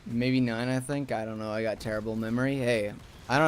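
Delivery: ordinary talk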